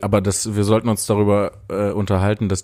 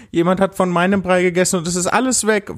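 put-on crying baby bear; 9 semitones higher than usual. A narrator's reading. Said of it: "weinte das Bärenbaby".

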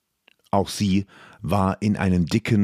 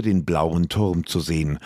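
ordinary delivery, nothing marked

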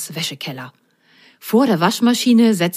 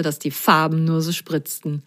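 ordinary delivery

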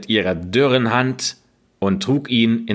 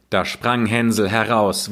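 usual speaking voice

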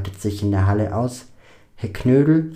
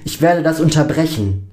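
louder, higher determined voice; defiant protest; 5 semitones higher, 5 dB louder than usual. A narrator's reading. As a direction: stand up firmly against the voice. Lukas defies the voice. (entschlossen und laut widersprechend)

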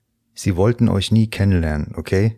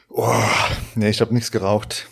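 usual speaking voice

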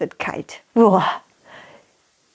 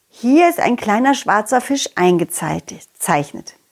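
ordinary delivery

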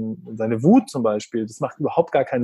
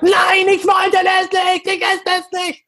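put-on high, shrill toddler yell of refusal; child screaming; 3 dB louder, slower than usual. Son screaming that he won't eat the cut bread, yelled. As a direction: scream it like a furious little kid. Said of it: "er schreit".

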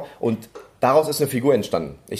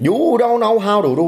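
much higher, louder Vietnamese-accented English; 11 semitones higher, 5 dB louder than usual. Shown as a demonstration. Comedian switching back into his Vietnamese character voice. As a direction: hit it high in a Vietnamese accent. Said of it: "Vietnamesin aus Amerika".

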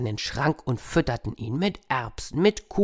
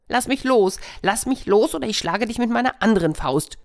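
hare screaming; 8 semitones higher than usual. Acted. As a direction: scream to be let go. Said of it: "schrie der Hase".

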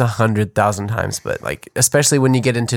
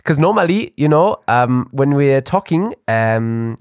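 exaggerated mock German accent; halting German-accented English. Shown as a demonstration. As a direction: put on a thick German accent. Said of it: "Can you do a German accent".

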